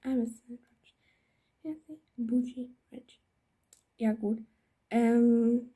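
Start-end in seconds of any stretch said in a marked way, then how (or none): 0.0-3.2 whispering. Real, not acted. Reading names in a whisper.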